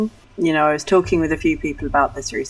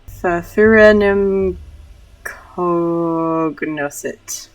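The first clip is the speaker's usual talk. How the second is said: slow, drawn-out pompous Latin; pretentious Dankovsky; 3 semitones higher, 5 dB louder, much slower than usual. Acted. Streamer reads Dankovsky's Latin, slowly and pretentiously. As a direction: draw out every syllable, lightly pretentious. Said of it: "more accurate for pretentious Mister Dankovsky".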